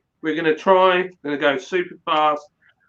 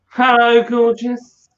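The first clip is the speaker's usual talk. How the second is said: flirty greeting; 7 semitones higher, 4 dB louder, slower than usual. Real, not acted. Answering wife's phone call.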